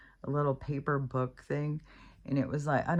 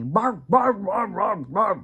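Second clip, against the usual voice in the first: loud, high-pitched imitated barking; insistent dog barks, pushed up and louder; yelling dog; 6 semitones higher, 8 dB louder, slower than usual. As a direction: bark loudly like a dog telling someone off. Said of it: "she starts yelling at me".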